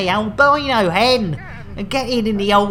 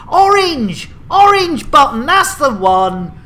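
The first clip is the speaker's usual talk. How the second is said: higher, louder burst of recall; excited realisation; 4 semitones higher, 6 dB louder than usual. Pretend. Host finding the right button at last, excited. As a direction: call it out as it suddenly comes back.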